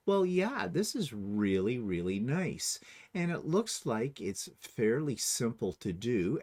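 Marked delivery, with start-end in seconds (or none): none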